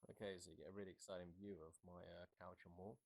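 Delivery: distant, muffled sound coming through the headset speakers; heard through helmet speakers. Real, not acted. Rider testing the intercom audio for viewers, through the helmet speakers. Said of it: "some audio through the actual speakers".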